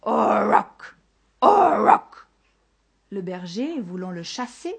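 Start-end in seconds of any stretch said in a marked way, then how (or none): 0.0-2.3 loud drawn-out owl screech; hoarse, dismal owl cry. A narrator's reading. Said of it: "criant d'une voix rauque et lugubre". (cri de hibou rauque et lugubre)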